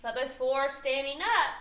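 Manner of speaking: expressive storybook reading with lifted stresses; story read to children. A narrator's reading. Teacher reading a picture book to young children, animated storytelling. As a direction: read it aloud, drawing out the big moments.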